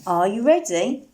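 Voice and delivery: ordinary delivery, nothing marked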